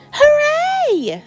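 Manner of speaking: high, loud, drawn-out cheer; excited shout. Acted. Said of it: "shouted George".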